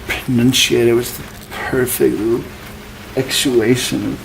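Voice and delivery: put-on over-enunciated Portland accent, dragged out slow at the end. Comic mocking locals who pronunciate everything perfectly, exaggerated Portland accent. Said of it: "You guys know about the Portland accent".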